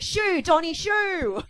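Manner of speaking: loud high shoo; shooing him off. Pretend. Wife loud and high, shooing husband off. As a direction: shout him away like a pest. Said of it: "and that way she could just bat him away, like".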